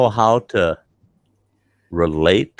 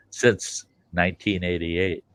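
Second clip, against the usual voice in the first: usual speaking voice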